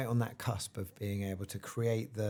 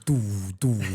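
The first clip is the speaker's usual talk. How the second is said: drum fish call; 3 semitones lower, 4 dB louder than usual. Shown as a demonstration. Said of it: "you've just got this doo".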